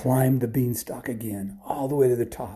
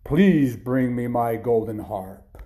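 slow, loud, booming giant; giant's command; 5 dB louder, slower than usual. Acted. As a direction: draw out the request as a huge giant. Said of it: "the giant said".

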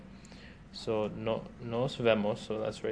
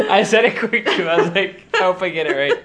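laughing while talking; giggling through the words; 7 semitones higher, 9 dB louder than usual. Real, not acted. Laughing through embarrassed retelling.

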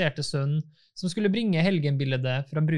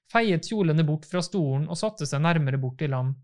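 ordinary delivery

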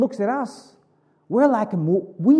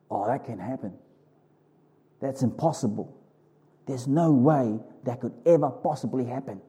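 quiet doubting; 7 semitones lower, 5 dB quieter than usual. Retold or imitated. Doubting natural mind voiced.

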